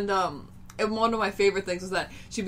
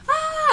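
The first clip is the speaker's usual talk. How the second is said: much higher, louder mock fan shriek; crowd scream; 7 dB louder than usual.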